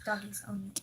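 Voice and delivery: soft whispered aside. Real, not acted. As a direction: breathe it in a whisper.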